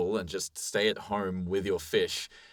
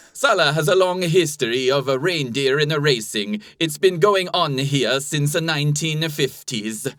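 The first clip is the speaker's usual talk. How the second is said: put-on Italian-turned-Finnish accent; 5 semitones higher, 7 dB louder than usual. Reading a reindeer-racing quote in a mock Finnish accent. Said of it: "try and do an Italian accent ... it'll sound Finnish".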